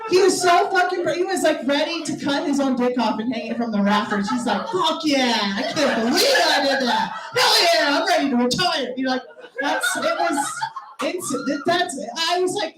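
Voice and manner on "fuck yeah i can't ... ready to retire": high, loud mock bro cheer; the guy bragging about his performance, in a loud mock cheer; mock triumphant bro. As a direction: ham up a proud dude bragging. Guy's triumphant retold boast.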